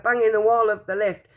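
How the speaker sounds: plain reading voice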